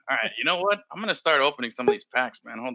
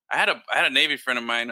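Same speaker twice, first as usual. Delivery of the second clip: ordinary talk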